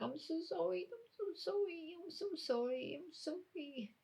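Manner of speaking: high, soft, whimpering apology; squeaky, tearful pleading that fades; Trixie. Acted. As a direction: repeat it in a tiny panicked voice. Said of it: "Trixie's bopping about, going like this".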